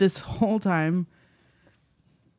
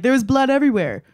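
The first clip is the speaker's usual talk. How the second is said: higher, louder and fast, hammed-up horror; mock dramatic; 6 semitones higher, 5 dB louder, faster than usual. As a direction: play up the gore as a joke. Mock dramatic retelling of book.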